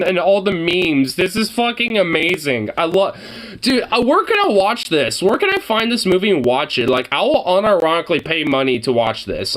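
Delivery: usual speaking voice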